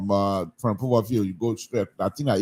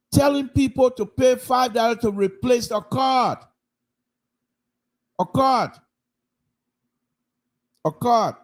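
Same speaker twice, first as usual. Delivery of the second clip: indignant shout; 11 semitones higher than usual. Real, not acted. Indignant rant about fee.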